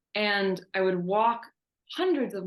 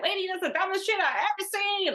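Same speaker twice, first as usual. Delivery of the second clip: high, put-on voice of a scornful stranger; mock passing lady; 12 semitones higher, faster than usual. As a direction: imitate the lady yelling from her car, much higher. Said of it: "some lady driving by was like".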